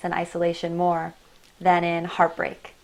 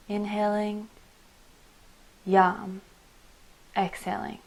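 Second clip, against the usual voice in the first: guided meditation. Acted. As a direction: guide them gently inward, breath by breath. Leads a meditation.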